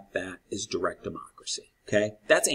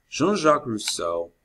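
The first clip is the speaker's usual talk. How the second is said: Jean-Jacques Rousseau in a French accent, a little higher; lightly French Jean-Jacques Rousseau; French pronunciation; 4 semitones higher, 3 dB louder than usual. Shown as a demonstration. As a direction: say Jean-Jacques Rousseau the French way, zhahn-zhahk roo-SOH. Full French name performed.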